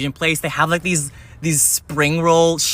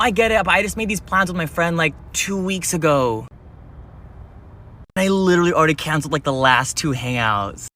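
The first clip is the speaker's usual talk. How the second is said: ordinary talk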